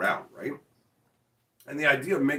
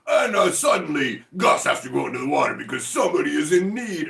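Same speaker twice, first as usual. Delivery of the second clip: overblown TV announcer; 5 semitones higher, 7 dB louder than usual. Retold or imitated. Mocking a beach show's announcer, melodramatically intense. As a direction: make it sound like life or death. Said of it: "make everything seem super intense".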